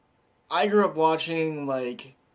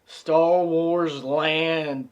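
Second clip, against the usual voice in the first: drawn out slowly, louder; hyped announcement; 3 semitones higher, 6 dB louder, much slower than usual. Real, not acted. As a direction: sell it like a theme park ad. Repeated for emphasis, hyped.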